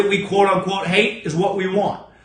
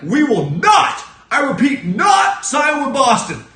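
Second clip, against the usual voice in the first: slow, loud, higher proclamation; mock defiant declaration; 4 semitones higher, 4 dB louder, slower than usual. Host mocking Rich Paul's statement, slow loud proclamation. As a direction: hammer it out like a press statement.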